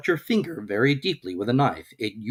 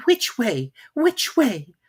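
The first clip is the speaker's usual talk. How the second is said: put-on much higher, anxious girl; worried little girl checking; 12 semitones higher, 4 dB louder, much slower than usual. A narrator's reading. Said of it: "said anxiously to herself".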